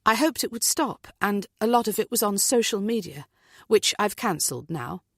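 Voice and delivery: plain reading voice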